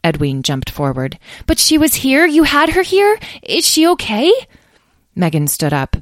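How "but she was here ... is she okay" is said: put-on high, loud, urgent young voice; anxious child. Young dragon jumps forward asking after Stella, anxious child. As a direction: pipe up with worried, urgent questions.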